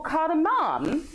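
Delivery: cardamom ending in an open "-mahm"; cardamom with a long "ah" in the last syllable, rising; alternative pronunciation. Shown as a demonstration. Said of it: "correct to call it cardamom".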